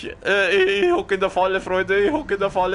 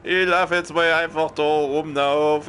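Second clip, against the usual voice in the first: ordinary delivery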